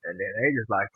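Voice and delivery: ordinary talk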